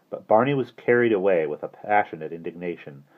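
usual speaking voice

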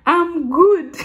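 high, loud mock American accent; hammed-up accent imitation; put-on local accent. Shown as a demonstration. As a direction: show off the American accent, exaggerated. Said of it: "You know that accent".

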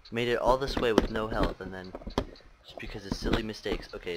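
usual speaking voice

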